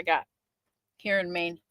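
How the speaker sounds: talking while licking greasy fingers. Real, not acted. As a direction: slurp between words, fingers in mouth. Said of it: "Sorry about the slurping noises you're hearing from me licking my fingers".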